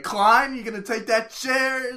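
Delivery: loud, high imitation of an aggressive talker; boisterous enunciation. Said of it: "enunciates, and he's like".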